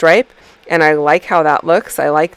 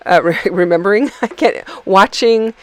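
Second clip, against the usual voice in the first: laughing; 3 semitones higher than usual. Real, not acted. Laughing at her own forgetting.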